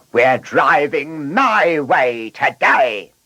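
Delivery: high, slow, wilful declaration; defiant car. A narrator's reading. Blunderbuss deciding to drive his way today, defiant. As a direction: stretch it out as a bossy car taking charge.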